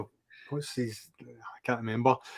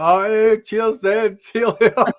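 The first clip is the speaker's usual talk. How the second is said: much higher, louder cheery send-off; mock Stevie farewell; 9 semitones higher, 7 dB louder than usual. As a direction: chirp it out high and loud, imitating a mate. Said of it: "Stevie's just like".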